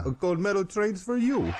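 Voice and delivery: lightly hammed Russian comic; Russian accent. Russian reversal joke.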